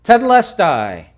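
high, loud, drawn-out cry; acted shout of completion; loud yell. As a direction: cry it out as last words. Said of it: "yelled loudly".

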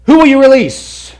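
high, loud demanding shout; Pilate yelling. Retold or imitated. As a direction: hurl the question at the mob.

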